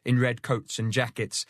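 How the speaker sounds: plain reading voice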